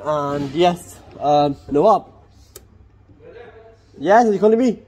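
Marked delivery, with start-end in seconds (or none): none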